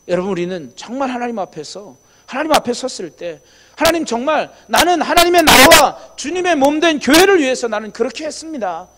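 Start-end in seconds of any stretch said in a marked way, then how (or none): 3.8-8.9 raised, louder fervent claim before God; earnest plea. Acted. Believer answering God at judgment, fervent and loud. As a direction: plead your case to God with full conviction.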